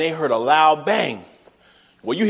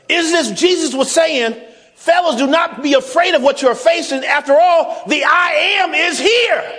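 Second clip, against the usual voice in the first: preaching; 8 semitones higher than usual. Acted. Preaching builds to climax.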